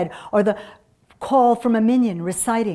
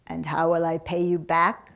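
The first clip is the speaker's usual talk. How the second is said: lightly voiced sick woman, lower; a hint of a weary invalid; 4 semitones lower than usual. A narrator's reading. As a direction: ask doubtfully as an ailing widow. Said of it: "says the woman".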